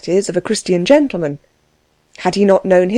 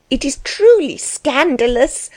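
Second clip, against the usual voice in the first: high-pitched, scandalized wife; higher, agitated exclamation; excited outcry; 7 semitones higher than usual. A narrator's reading. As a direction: cry out in excited indignation. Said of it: "cried his wife, greatly excited".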